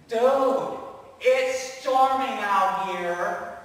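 loud, frantic shouting at the sleeping teacher; panicked yelling. Acted. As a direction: yell it over the storm in panic.

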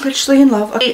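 ordinary talk, nothing marked

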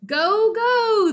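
high, loud cheering chant; crowd cheer. A narrator's reading. Crowd answering the librarian, loud excited cheer. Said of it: "the crowd cheered".

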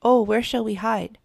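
panicked cry. A narrator's reading. Kittens fleeing the foxes look for cover, panicked cry. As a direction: cry out for a hiding place.

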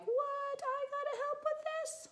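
high, whiny voice of disbelief; reluctant brother. Retold or imitated. Brother asked to help, whining in disbelief. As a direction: play the put-upon kid. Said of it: "He's like".

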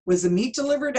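high, loud worried ask; anxious question. Acted. Mrs Rogers anxiously questions maid.

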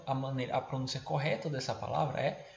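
ordinary talk, nothing marked

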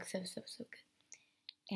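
slow soft whisper. Real, not acted. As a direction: breathe it out slowly.

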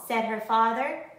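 plain reading voice

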